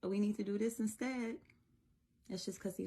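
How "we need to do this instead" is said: higher-pitched mommy voice; imitated mom steering her little one; gently correcting mommy. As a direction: correct a small child lightly. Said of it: "bringing a little correction".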